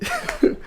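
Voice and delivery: brief high chuckle; a quick burst of laughter; amused laugh. Real, not acted. Laughing at the three-word clip, amused laugh.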